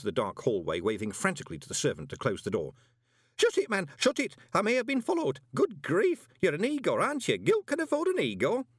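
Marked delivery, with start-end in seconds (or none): 3.4-8.7 high, loud, urgent orders; flustered man in a much higher voice; panicky visitor. A narrator's reading. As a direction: burst in frantic and demand the door be shut. Panicked visitor fears being followed.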